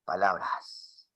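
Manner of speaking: soft, low, drawn-out word; low volume. Shown as a demonstration. Teacher repeating a volume demo, softly. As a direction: say it quietly and slowly. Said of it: "Te has dado cuenta que está el volumen".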